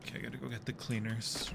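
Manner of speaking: ordinary talk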